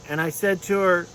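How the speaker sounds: ordinary talk, nothing marked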